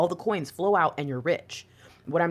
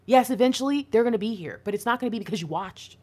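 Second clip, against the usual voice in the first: usual speaking voice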